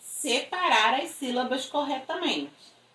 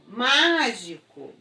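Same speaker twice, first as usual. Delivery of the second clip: slow mágico with long MÁ; mágico with a held, strong first syllable; stress on antepenultimate syllable; 3 dB louder, much slower than usual. Shown as a demonstration. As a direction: stretch the MÁ of mágico. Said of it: "quando a sílaba tônica for a antepenúltima".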